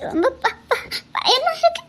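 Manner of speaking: too scared to get the word out; stammering fear. Acted.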